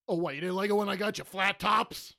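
loud, high indignant mock shout; imitating yelling dad. Retelling dad yelling on his birthday, indignant shout. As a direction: yell it like an offended father. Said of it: "when he yelled at me".

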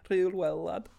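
bad Liverpool accent. Dad praising his child after the gig, bad Liverpool accent. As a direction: imitate a dad in rough Scouse. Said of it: "That's my bad Liverpool accent".

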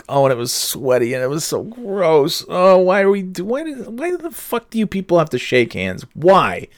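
high-pitched exasperated complaint; disgusted whining. Real, not acted. Podcaster griping about a sweaty handshake, disgusted high whine. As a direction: recoil in disgust, voice climbing high.

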